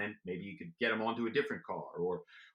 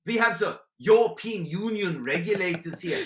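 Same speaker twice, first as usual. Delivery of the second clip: higher, louder put-on German accent, Gerhard calling from Frankfurt; hammed-up German accent for a spy ploy; German businessman on the phone in a mock German accent; 7 semitones higher, 9 dB louder than usual. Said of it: "My go-to was German".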